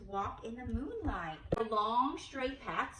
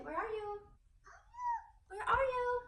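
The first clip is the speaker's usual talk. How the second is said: playful calling out; 14 semitones higher than usual. Shown as a demonstration. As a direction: call out like searching for someone. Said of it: "You say".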